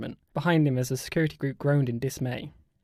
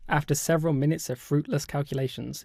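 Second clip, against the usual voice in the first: usual speaking voice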